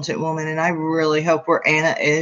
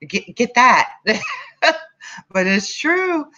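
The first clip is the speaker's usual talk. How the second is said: high-pitched, real giggly delivery; laughing while talking; 10 semitones higher than usual. Real, not acted. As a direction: crack up between phrases, voice shooting up. Cracks up at own remark.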